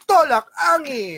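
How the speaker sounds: slow, high announcer flourish; sponsor tagline. Pretend. Co-host completes the sponsor mention, announcer-style. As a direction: draw out the brand name like an ad.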